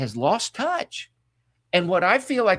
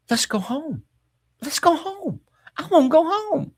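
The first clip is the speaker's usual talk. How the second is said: mother's voice; 14 semitones higher than usual. Retold or imitated. Dying mother quoted pleading.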